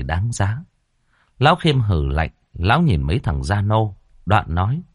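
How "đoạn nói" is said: ordinary delivery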